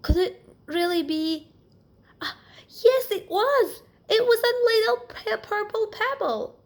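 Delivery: a little mouse who finally finds the purple pebble; rising excitement. Acted. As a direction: gasp it out as you spot the treasure. Alexander spots the pebble.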